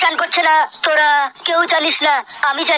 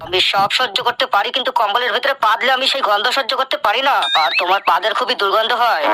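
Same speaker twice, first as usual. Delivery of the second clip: a hint of a lower put-on voice, grumbling; lightly voiced complaint; 7 semitones lower than usual. Husband complaining to his wife about the blanket, low grumble.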